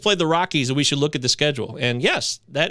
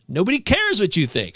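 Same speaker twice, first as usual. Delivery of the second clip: ordinary delivery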